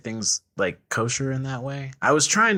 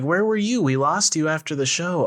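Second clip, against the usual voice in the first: put-on high excited party girl; excited friend voice; 7 semitones higher than usual. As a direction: squeal it like a gossiping friend.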